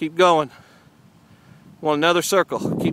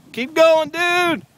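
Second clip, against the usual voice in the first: hoarse marathon cheering; 8 semitones higher, much slower than usual. Real, not acted. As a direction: cheer them on from afar. Cheering kid on bike.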